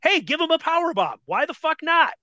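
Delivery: high, mock-carefree voice; sarcastic mimicry. Bookers sending an untrained rookie out, high sarcastic mimicry. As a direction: mimic someone casually dooming the rookie.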